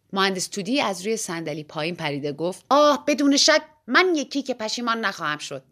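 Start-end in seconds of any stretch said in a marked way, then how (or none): 2.7-5.6 louder, higher confident comeback; boastful outburst; emphatic declaration. A narrator's reading. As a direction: exclaim it with full assurance. Engineer hotly answers the bet.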